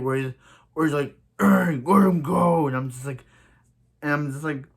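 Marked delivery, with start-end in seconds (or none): 1.4-2.7 slow, louder put-on Batman growl; Dark Knight voice. Mocking Diggle's Dark Knight impression, slow and put-on. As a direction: ham up a Batman line. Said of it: "Diggles talks like the Dark Knight".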